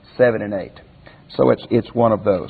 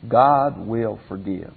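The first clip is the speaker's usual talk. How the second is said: ordinary talk, nothing marked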